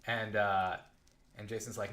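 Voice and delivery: ordinary delivery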